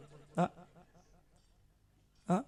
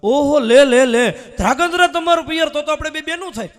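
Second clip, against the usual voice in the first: ઓહો, cheerful and loud, with a laugh; excited exclamation; 5 semitones higher, 6 dB louder than usual. Retold or imitated. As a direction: greet her like a long-lost sister. Excited woman greeting a new friend. (ઉત્સાહભર્યો, હસતો અવાજ)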